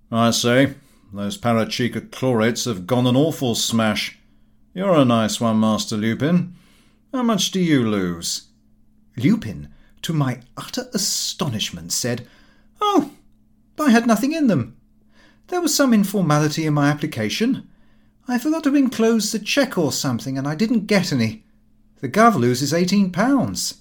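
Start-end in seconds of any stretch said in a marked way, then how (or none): 0.1-8.4 breezy family friend teasing, lightly voiced; lightly voiced Gowing. A narrator's reading. Friend teasing Lupin about the loss, lightly voiced. 12.8-23.8 lively son's voice, pitched high and fast; a brash young man breaking bad news breezily; young Lupin, higher. A narrator's reading. Son telling father the shares collapsed, brash and high. Son airily denies involvement.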